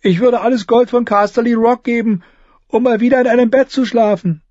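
high, whiny gloomy voice. Acted. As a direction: moan it like a glum squire. Gloomy Ed speaks.

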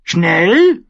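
loud, high raven squawk; rasping bird cry; croaking raven. A narrator's reading. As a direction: croak it high, rising at the end. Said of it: "krächzte der Rabe".

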